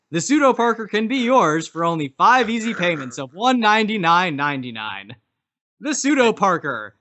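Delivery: pushy infomercial pitch; hard sell ad. Acted. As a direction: push the sale hard.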